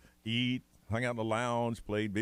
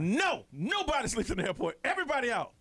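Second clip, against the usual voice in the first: stern airport staff; 10 semitones higher than usual. Retold or imitated. Airport staff ordering passengers out.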